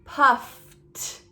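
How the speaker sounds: "puffed" with breathy f, then released t; added t ending. Shown as a demonstration. Teacher recapping the rule, "puffed" with t added after breath. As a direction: separate the t at the end of "puffed". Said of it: "you're going to add the t sound".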